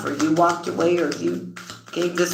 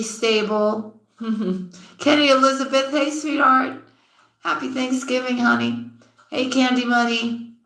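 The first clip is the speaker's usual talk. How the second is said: higher, bright sing-song greetings to each viewer; cheerful shoutouts; 6 semitones higher than usual. Real, not acted. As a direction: call out each name like welcoming friends.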